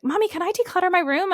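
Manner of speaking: put-on high, eager child's question; imitated little girl. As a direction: ask mom like a keen little kid. Imitates her young daughter asking.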